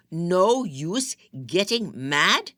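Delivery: exploding shout. Acted. Husband shouting her own words back at her, slow furious yell. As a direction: blow up on the repeat, stretching every word. Ahmed repeats, louder, exploding.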